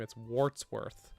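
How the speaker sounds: slow, deliberate Wartsworth, R sounded. Shown as a demonstration. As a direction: spell out Wartsworth slowly. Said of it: "I've been calling him Watsworth".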